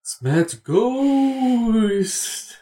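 very slow, high ghost voice; ghostly voice. Pretend. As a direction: moan it like a spooky ghost. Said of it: "I'm hearing a ghostly voice".

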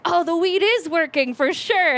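high-pitched retold wail; mock tearful realization. High teenager realizing the weed works, mock overwhelmed. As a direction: voice lifted, hammed-up overwhelmed realization. Retelling crying while high.

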